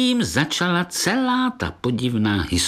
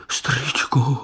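whispering; 4 semitones higher, slower than usual. A narrator's reading. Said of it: "zašeptal".

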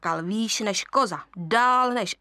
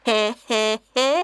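quiet laugh; 5 dB louder than usual. Shown as a demonstration. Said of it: "docela tichounce".

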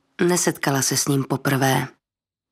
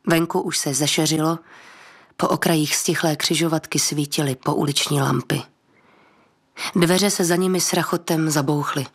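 plain reading voice

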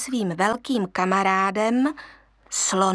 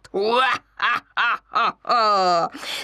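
hyena cackle. Acted. Hyena shows the cub a horrid cackle. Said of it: "příšerně chechtat".

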